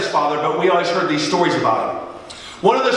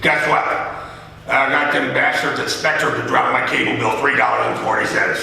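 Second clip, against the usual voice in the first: imitating his dad; 5 semitones lower than usual. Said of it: "how he would say it".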